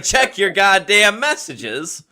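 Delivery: mock nagging shout. Nagging friend about messages.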